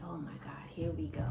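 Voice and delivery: a hushed, breathless oh my God; whispered dismay. Retold or imitated. Whispering dread at the subscription bill.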